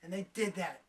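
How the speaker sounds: normal talking voice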